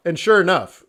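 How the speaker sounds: ordinary talk, nothing marked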